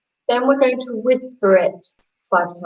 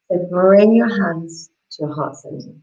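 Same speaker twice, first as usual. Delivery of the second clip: usual speaking voice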